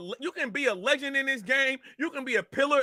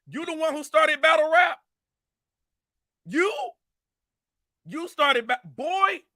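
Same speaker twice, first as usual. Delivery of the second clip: raised, higher angry call-out; angry shout; 4 semitones higher than usual. Real, not acted. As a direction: shout the accusation at him. Angry rant at a rival.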